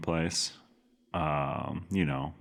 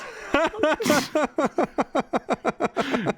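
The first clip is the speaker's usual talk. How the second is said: high burst of laughter; 5 dB louder than usual. Retold or imitated. Caller laughs hard.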